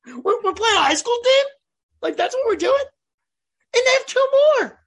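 can you believe this, voice climbing; incredulous rant. Real, not acted. Ranting about weak schedule.

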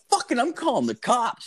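high-pitched retold outrage; indignant threat. Boy threatening the thief's sister in a retold quarrel, indignant. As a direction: threaten in a squeaky, worked-up voice.

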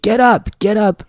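mildly angry retold command; furious nurse. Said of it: "fury in her eyes".

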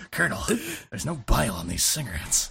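low-pitched game-hero voice; Snake impression. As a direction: drop the pitch and report in to the Colonel. Impersonating Snake on a codec call.

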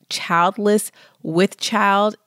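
normal talking voice

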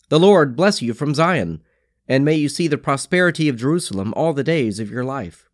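ordinary delivery

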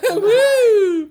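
high, loud crowd whoop; loud cheer. Acted. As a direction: whoop like a sitcom audience. Cheering with laughter.

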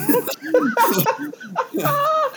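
real high burst of laughter. Real, not acted. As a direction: laugh hard and high at the memory. Laughing at his own story.

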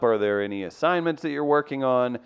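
ordinary talk, nothing marked

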